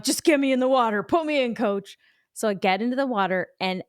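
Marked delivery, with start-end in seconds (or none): 0.0-2.0 fast, louder, higher, over-eager; eager plea. Retold or imitated. Nervous swimmer begging to get in, fast eager plea. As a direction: beg the coach to send you in. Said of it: "I'm like".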